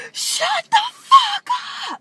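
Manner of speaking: high, loud mock shriek; imitating her yell. Retelling how the crazy woman yelled at them. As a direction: scream it like the crazy woman did. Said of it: "And she goes".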